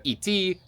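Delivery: iti spoken as Russian ee-TEE, both vowels clear; Russian verb. Shown as a demonstration. Explaining Russian verbs of motion, iti said the Russian way. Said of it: "You have so many words about to go".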